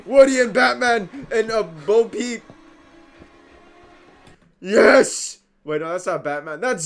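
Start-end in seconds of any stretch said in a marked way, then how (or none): 0.0-2.4 "Woody" hit high and loud; stress on Woody. Shown as a demonstration. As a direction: punch "Woody" hard and high. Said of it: "we got to get the emphasis on the Woody".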